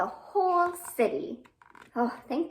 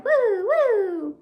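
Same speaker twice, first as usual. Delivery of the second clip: high, loud playful woo woo; 9 semitones higher, 10 dB louder than usual. Acted. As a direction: whoop like a spinning yo-yo. Yo-yo noises in the rhyme.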